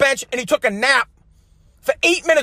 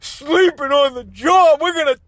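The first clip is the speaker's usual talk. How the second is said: higher, slow, syrupy fake-sorry politician voice; mock governor apology; 4 semitones higher than usual. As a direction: parody a governor's insincere press apology. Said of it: "Governor's gotta come out and".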